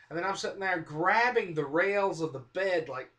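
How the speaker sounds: ordinary talk, nothing marked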